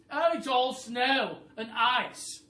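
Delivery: high, loud bossy bird voice; a high, loud, clever-bird voice; roly-poly bird voice. Acted. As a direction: squawk it like a know-it-all bird. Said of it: "said the roly-poly bird".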